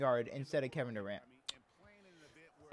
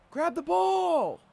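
high, slow, drawn-out yell; exasperated yell; 9 dB louder, much slower than usual. Real, not acted. Gamer yelling at his slow player on screen, drawn-out high yell. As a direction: stretch it out, completely fed up.

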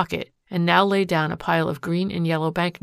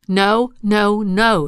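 loud, higher refusal; a wife loudly refusing his touch; crying out; 3 semitones higher, 8 dB louder, much slower than usual. A narrator's reading. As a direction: throw out each no, loud. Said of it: "she cried".

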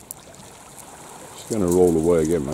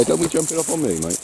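higher scolding; 7 semitones higher than usual. Real, not acted. Dog jumping up on him.

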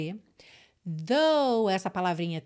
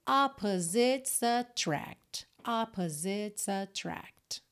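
slow demo reading; much slower than usual. Shown as a demonstration. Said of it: "Esse of boy, ele quase não aparece".

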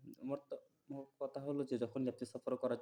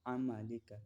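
stretched out syllable by syllable; slow Arabic recitation; much slower than usual. Shown as a demonstration. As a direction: draw the word out for learners. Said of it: "আইন উচ্চারণ করে নিতে হবে".

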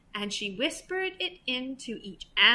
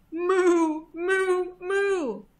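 higher repeated moos; duck mooing; 6 semitones higher, much slower than usual. A narrator's reading. Duck tricks the thieves, high moos. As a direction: moo three times like a cow. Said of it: "the duck on the pond said".

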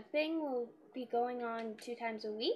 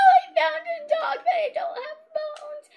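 excited squeal; 15 dB louder than usual. Real, not acted. Finds a dog, squeals.

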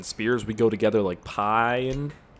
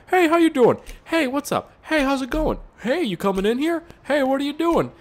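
high, chirpy fake-friendly greeting; mocking perky greeter; 3 dB louder than usual. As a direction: ham up an overeager shop greeter. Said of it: "say that every single time".

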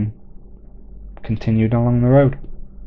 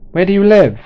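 put-on much higher, loud boyish call; 10 semitones higher, 9 dB louder than usual. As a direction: shout it down the street. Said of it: "Simon called out".

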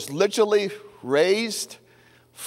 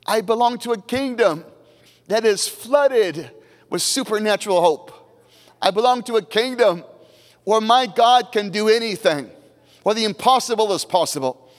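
higher, ringing proclamation; preaching at full force; 6 semitones higher than usual. Acted. Pastor declaring the kingdom to the congregation, ringing proclamation. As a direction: proclaim it like a pulpit crescendo.

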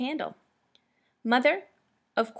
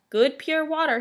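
street vendor's call; 6 semitones higher, 5 dB louder than usual. A narrator's reading. Water seller passing with his cask, calling out. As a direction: call your wares down the street. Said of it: "he called".

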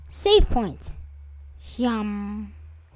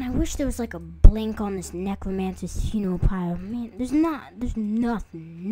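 usual speaking voice

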